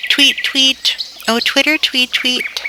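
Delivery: high, chirpy little bird voice; talking bird. A narrator's reading. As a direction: chirp the words like a small songbird. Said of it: "the birds were talking".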